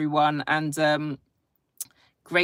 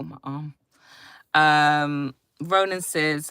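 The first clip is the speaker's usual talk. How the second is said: ordinary talk, nothing marked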